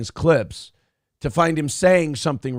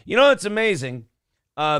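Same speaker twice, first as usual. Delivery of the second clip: ordinary delivery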